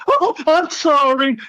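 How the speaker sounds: a hint of taunting sarcasm; mock apology. Opponent's mocking apology retold.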